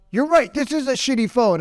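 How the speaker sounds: match his anger by shouting agreement; yelling back in agreement. Retold or imitated. Store owner yelling back at the angry customer. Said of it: "he started yelling back".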